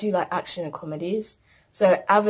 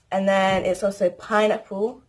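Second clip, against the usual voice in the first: usual speaking voice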